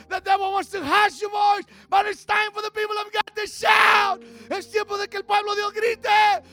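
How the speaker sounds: high, loud shouted proclamation; full-force revival preaching; Josiah Limones preaching. Retold or imitated. Calling God's people to shout, fervent preaching. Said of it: "Brother Josiah Limones preached".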